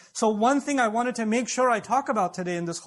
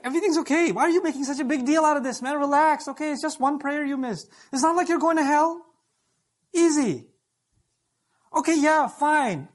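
tempting devil; 5 semitones higher than usual. Acted. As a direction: play the devil talking him out of guilt. Devil voiced soothing sinner.

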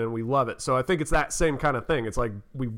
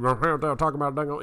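garbled Southern mumble impression; Boomhauer; slower than usual. Illustrating the Southern Cockney to the co-host, mumbled cartoon impression. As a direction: run the words together in a mumbled drawl. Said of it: "Boomhauer and King of the Hill. It's just so, like".

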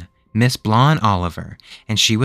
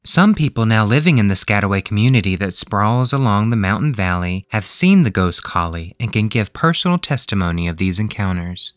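ordinary delivery

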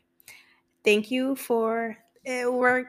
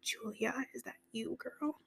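softer, hushed disbelief to herself; awed whisper; 15 dB quieter than usual. Retold or imitated. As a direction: whisper it like you can't believe your ears. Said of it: "I was like, gasps".

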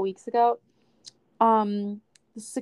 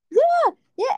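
much higher and louder, impatient and dismissive; mock exasperated guy; 6 dB louder than usual. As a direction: mimic the annoyed ticket guy brushing her off. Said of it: "sighing, like".

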